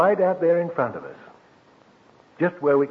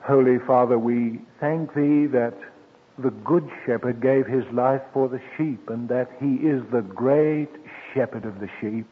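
humble spoken prayer; 2 semitones lower than usual. Acted. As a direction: pray for the whole congregation. Leads closing prayer.

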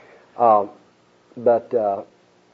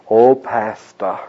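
slow, syrupy "Oh, pastor"; fawning mock greeting; 2 semitones lower, 3 dB louder, much slower than usual. Preacher mocking a two-faced church member, slow and fawning. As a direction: drag it out like a flatterer. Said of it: "To my face".

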